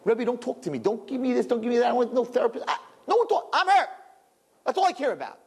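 high, pushed-away hurting protest; hurt student. Acted. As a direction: rebuff the Rebbe, too hurt to listen. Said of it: "I don't care who's talking".

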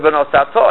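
"V'nosato" with the final "to" stressed; stress on last syllable. Shown as a demonstration. Teacher citing the verse correctly, last syllable stressed. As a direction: land the accent on the end of "V'nosato". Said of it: "as it's supposed to be pronounced".

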